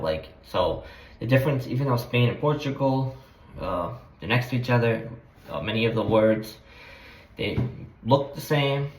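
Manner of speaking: normal talking voice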